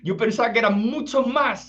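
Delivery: a yogi overjoyed it's so few; delighted yogi. Retold or imitated. Yogi tells God, overjoyed, high and loud. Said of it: "dice el yogi sonriente y en celebración".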